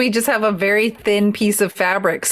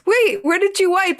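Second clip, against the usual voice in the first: surprised; 10 semitones higher than usual. Retold or imitated. Boyfriend's alarmed question retold.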